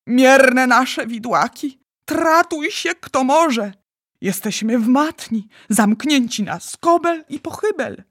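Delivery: high, loud lamenting outburst; anguished anger. A narrator's reading. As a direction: wail in angry torment. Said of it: "z gniewem i udręką w głosie".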